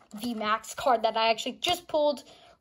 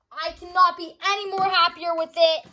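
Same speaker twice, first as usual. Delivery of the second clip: ordinary delivery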